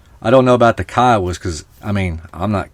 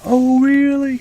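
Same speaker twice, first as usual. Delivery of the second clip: imitating an old lady on the phone again; mock old auntie; slower than usual. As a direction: echo the auntie's surprise, lighter. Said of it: "they're old Indian ladies".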